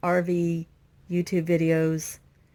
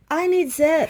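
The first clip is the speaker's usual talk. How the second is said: higher and louder mock cry of I need Zeb; theatrical higher, louder call for Zeb; mock plea; 8 semitones higher, 5 dB louder than usual. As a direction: wail it like a helpless plea. Pleading for help with wiring.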